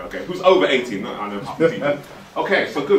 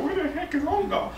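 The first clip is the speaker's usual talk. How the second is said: much higher, quieter baffled voice; puzzled mock quote; 14 semitones higher, 6 dB quieter than usual. Mimicking people who never heard of Ongar, baffled high voice. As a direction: squeak it out like a confused Londoner. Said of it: "they're like".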